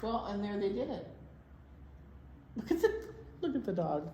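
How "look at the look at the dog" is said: ordinary talk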